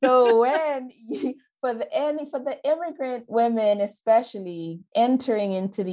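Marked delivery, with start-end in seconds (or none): 0.0-3.8 still laughing. Real, not acted. Laughing after joke.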